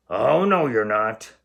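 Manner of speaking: loud troll roar. Acted. Troll roaring at the big goat, loud. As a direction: roar the denial. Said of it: "roared the troll".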